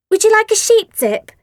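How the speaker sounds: very high, loud fake-sweet offer; sickly sweet put-down; sweetly. A narrator's reading. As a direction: Asti faking sweetness at Phoebe. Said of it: "looking sweetly at Phoebe".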